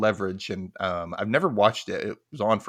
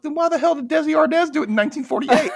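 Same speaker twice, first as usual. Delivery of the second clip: much higher, louder incredulous outburst; exasperated disbelief; 7 dB louder, faster than usual. Retold or imitated. Reading conflicting song history online, exasperated high outburst. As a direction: blurt it in mock outrage.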